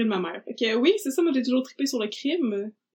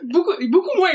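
laughing; 6 semitones higher, 7 dB louder than usual. Real, not acted. Laughing after loud laugh.

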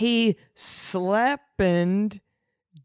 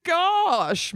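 mild mock dismay; 9 semitones higher, much slower than usual. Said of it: "not quite the reaction I would have".

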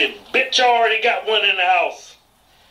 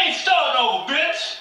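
normal talking voice

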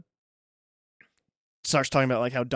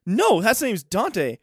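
shocked stoner; 9 semitones higher, 8 dB louder than usual. Retold or imitated. Stoner Jay shocked about Sergio's heart attack, much higher, louder, hammed-up alarm. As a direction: ham up a stoner's alarm, rising.

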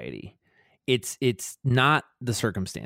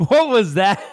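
laughing out the words, higher and louder; cracking up at himself; 10 semitones higher, 10 dB louder than usual. Real, not acted. Laughing at own botched impression.

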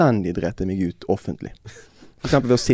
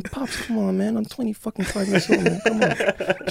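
high, softer mock protest; embarrassed son; 10 semitones higher, 4 dB quieter than usual. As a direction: whine back at dad, half laughing. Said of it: "Og sånn".

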